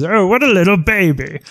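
high, sneering taunt; mock the crying hero; mocking villain. Villain Koopa taunting the crying player, high sneer. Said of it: "He says".

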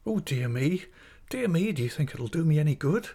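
high, plaintive dithering; helpless cry. Acted. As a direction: wail mildly, rising into a helpless question. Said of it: "in a helpless manner".

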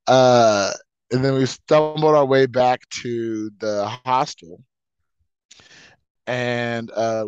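ordinary talk

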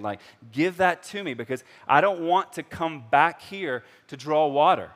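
loud, high, eager begging; eager plea. Retold or imitated. Woman begging Jesus for living water, loud and eager. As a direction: grab the offer with both hands.

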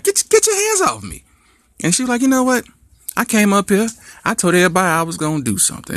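high, sharp shout; high outraged scream; furious girlfriend. Acted. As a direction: snap it high and hurt.

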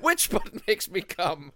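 slow goofy question; drawn-out mock puzzled ask; slow silly voice. Joking about phone button.

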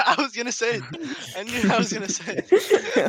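laughing while talking. Real, not acted. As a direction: speak through laughter. Laughing after outburst.

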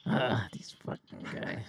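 unvoiced grumbling aside; muttering under breath. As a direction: mutter it to yourself annoyed. Barmaid mutters.

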